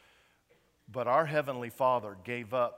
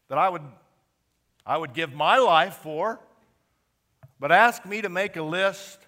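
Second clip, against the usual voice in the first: passionate preaching; 5 semitones higher, 10 dB louder than usual. Real, not acted. Preaching builds with emotion.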